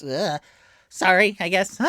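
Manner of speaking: loud, grudging apology; Tipsy. Acted. Game master voices Tipsy's half-hearted apology, loudly blurted. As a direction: blurt a loud "sorry" in character. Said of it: "Tipsy goes".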